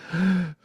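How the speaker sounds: sharp startled gasp; mock gasp. Spiritual teacher acting out clinging to control, startled gasp. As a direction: gasp as if someone knocks.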